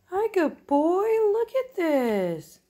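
pet-directed cooing. Real, not acted. Greeting a good boy at Squinty hour, high cooing. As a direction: coo excitedly at a beloved pet.

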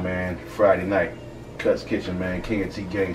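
usual speaking voice

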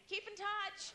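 high, chirpy sign-off; mock cheery farewell. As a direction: call it out with absurd friendliness.

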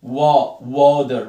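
loud "وا" then "واتر" with long aw; AW sound drill. Shown as a demonstration. Teacher drills A after W, loud long "وا، واتر". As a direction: punch "وا", then build it into "واتر" as water. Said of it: "بنطق ال A O".

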